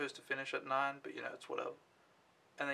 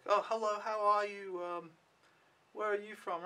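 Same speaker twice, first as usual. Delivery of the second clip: higher, sing-song imitation of young girls; shy little girls; 6 semitones higher than usual. Imitating eight girls greeting a foreigner, higher girlish voice. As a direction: mimic a gaggle of curious little girls. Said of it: "eight girls, they were all like".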